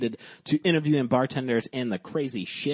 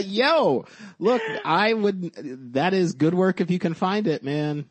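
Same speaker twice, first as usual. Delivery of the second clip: excited; 8 semitones higher, 7 dB louder than usual. Real, not acted. Laughing, excited reaction.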